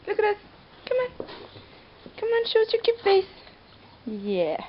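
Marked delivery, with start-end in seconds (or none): 0.1-3.2 sing-song call to a puppy; squeaky praising a dog. Real, not acted. Coaxing dog for a photo.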